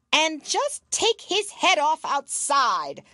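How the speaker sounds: high, loud slow royal command; cruel Queen. Acted. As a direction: order it coldly like a tyrant. Queen voiced.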